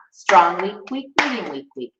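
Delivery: accent the first beat hard, then let the weak ones drop; six eight pulse. Shown as a demonstration. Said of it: "Six eight time".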